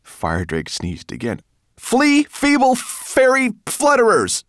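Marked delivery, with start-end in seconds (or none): 1.8-4.4 high, loud, drawn-out shout. A narrator's reading. Ben drives off the elves with a chant, slow high shout. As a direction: shout each word slowly, high-pitched. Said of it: "shouted Ben".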